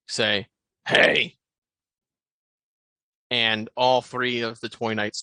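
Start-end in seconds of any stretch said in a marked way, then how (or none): 0.9-1.4 low gravelly call of hey; low gravel vigilante growl. Acted. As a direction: growl a sharp hey from the dark. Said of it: "a low, gravelly voice".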